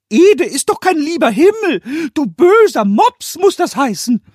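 hohe, laute Koboldstimme, vorlaut; frecher Kobold. A narrator's reading. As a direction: kecker Kobold belehrt Meister Eder. Pumuckl voiced by narrator. (Pumuckl schimpft frech über den Hund, hoch und laut)

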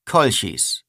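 plain reading voice

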